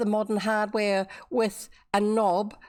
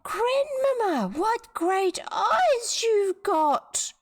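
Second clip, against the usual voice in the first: put-on higher, slow wide-eyed little girl; 13 semitones higher, much slower than usual. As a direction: sound small and amazed. Girl's voice, much higher.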